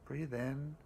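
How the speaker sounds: slow, calm meditation voice with long pauses; guided meditation. Acted.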